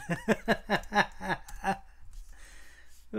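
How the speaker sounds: long airy laugh with no voice; a helpless wheezing laugh; breathless laugh. Real, not acted. Streamer cracking up at a viewer's link trick, wheezing breathless laugh.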